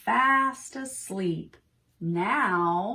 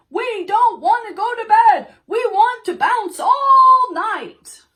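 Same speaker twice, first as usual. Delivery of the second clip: much higher and louder, a hammed-up shout; monsters refusing bed; 11 semitones higher, 13 dB louder than usual. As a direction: shout it like stubborn monsters at bedtime.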